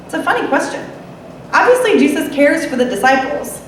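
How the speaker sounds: ordinary delivery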